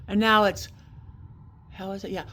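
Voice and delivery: ordinary talk, nothing marked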